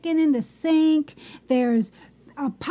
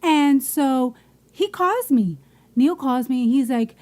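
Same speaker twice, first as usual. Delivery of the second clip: ordinary delivery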